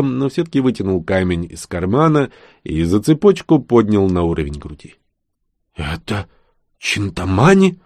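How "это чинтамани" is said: surprised. Acted. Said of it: "удивлённо спросила Роксана".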